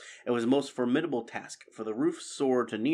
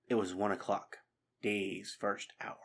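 hushed whisper; 6 dB quieter than usual. A narrator's reading. Bell tolls, solemn hushed moment.

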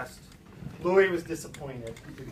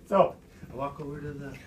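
ordinary delivery

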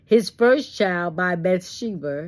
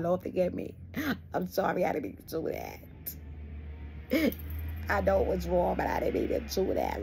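hushed, whimpering plea to the Lord, hammed up; mock pleading prayer; 10 dB quieter than usual. Sinner mocked for begging God, hushed pleading. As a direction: beg forgiveness in a soft, breathy mock whine.

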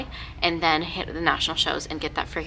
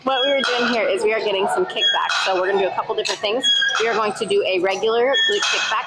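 raised voice over gym noise; loud over noise; 2 semitones higher, 5 dB louder than usual. Real, not acted. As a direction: project over the music. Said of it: "so much noise right now".

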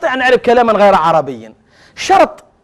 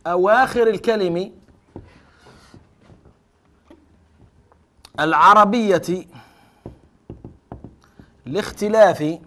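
definition dictated word by word, long pauses; slow dictation; much slower than usual. Shown as a demonstration. Teacher dictating the grammarians' definition slowly. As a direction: spell out the definition slowly for the class to write. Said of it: "فقالوا".

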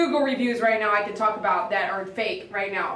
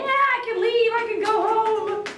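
playful relief; 11 semitones higher than usual. Real, not acted. Joking relief, caught up.

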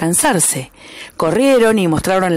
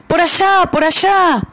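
higher, louder urgent call; pointing shout; 10 semitones higher, 3 dB louder than usual. A narrator's reading. The rhea pointing the group toward the wolf, loud and high. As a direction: call them to look over there. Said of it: "señaló el ñandú".

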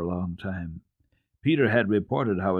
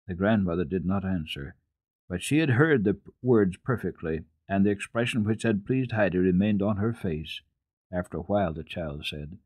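plain reading voice

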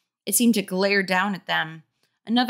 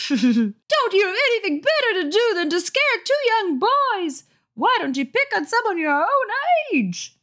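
much higher, shrill scolding; angry scolding mother; 13 semitones higher, 3 dB louder than usual. A narrator's reading. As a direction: wag your finger as you scold. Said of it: "turned angrily".

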